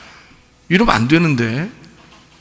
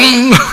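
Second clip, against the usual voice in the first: short high laugh; 9 semitones higher, 4 dB louder than usual. Real, not acted. Laughs at own story.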